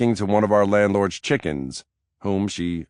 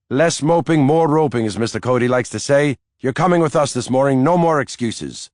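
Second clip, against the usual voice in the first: bossy cheer; 7 semitones higher, 4 dB louder than usual. A narrator's reading. Nanny urging him out.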